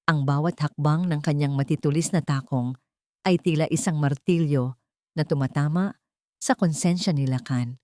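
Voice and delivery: ordinary delivery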